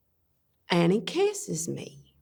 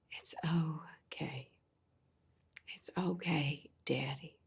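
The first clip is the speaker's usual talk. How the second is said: whispered reassurance; 4 semitones lower, 15 dB quieter than usual. Acted. Daughter to father afterwards, hushed whisper. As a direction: whisper it low, barely there.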